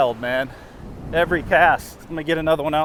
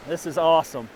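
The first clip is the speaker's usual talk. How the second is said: ordinary delivery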